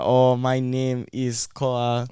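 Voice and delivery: put-on slow, halting Japanese accent; broken English Japanese accent. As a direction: play a struggling exchange student. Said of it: "speaking in, like, broken English".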